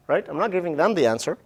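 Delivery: ordinary talk, nothing marked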